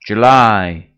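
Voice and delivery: loud unison chant of "July."; whole class shouts it back; group repeating after leader. Pretend.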